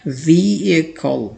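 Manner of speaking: व्हीकल said slowly as "vee-ih-kul", h silent; correct pronunciation. Shown as a demonstration. Teacher slowly modelling व्हीकल as vee-ih-kul. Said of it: "वो कुछ इस तरह से होती है".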